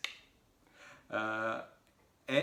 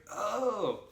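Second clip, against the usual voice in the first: high, imitated "oh" of understanding; dawning realisation; 10 semitones higher, 3 dB louder than usual. Australian guy finally understands, high mock-realisation. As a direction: act out finally getting it. Man finally gets the joke.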